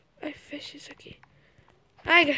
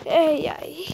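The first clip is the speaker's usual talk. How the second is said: normal talking voice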